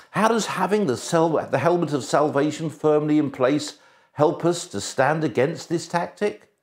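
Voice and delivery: normal talking voice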